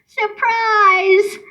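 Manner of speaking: high, drawn-out gleeful call; high-pitched mock yell, little added volume; yelled surprise. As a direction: sing out the surprise, pitch high then falling. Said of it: "I yelled".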